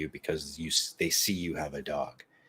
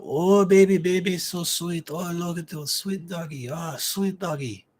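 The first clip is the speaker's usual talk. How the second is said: high cooing over a dog; gushing stranger; 11 semitones higher, 5 dB louder than usual. Retold or imitated. Stranger cooing at someone's dog, high mock gushing. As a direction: imitate a stranger fawning in a high voice. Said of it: "from kinda far away starts going".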